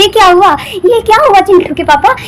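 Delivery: ordinary talk, nothing marked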